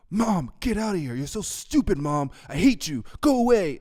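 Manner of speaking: high, loud bratty yelling at mom; rude kid yelling. Retold or imitated. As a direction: scream at your mother like a spoiled brat. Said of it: "And he's like".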